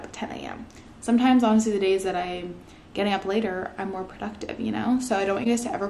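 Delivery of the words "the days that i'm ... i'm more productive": ordinary talk, nothing marked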